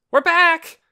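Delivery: loud excited shout. A narrator's reading. Boy bursting through the door, shouting. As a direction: Torin bursts in yelling to everyone. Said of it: "he shouted".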